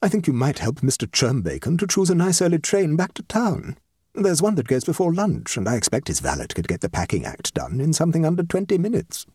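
lightly voiced decisive guest; brisk guest, practical. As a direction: offer ready advice as a society lady.

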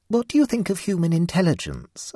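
a feeble attempt at conversation; lame. Mavis fumbling a question to the cat, lame. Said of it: "asked Mavis Pellington lamely".

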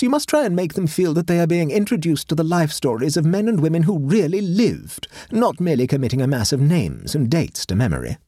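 high, imperious society matron; haughty grand lady. Acted. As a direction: lecture like a pompous hostess. Voices Mrs Cabal.

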